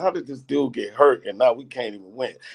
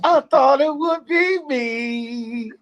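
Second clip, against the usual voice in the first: higher, slow, hammed-up wailing sing; hammed-up mock sob singing; mock crying; 13 semitones higher, slower than usual. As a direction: wail the lyric in fake heartbreak. Mock-crying sung lyric.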